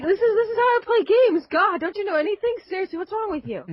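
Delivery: high-pitched, exasperated mock huff; mock indignant retort. Gamer defending rigged-up Game Boy to mom, mock indignant. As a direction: whine it like a misunderstood kid.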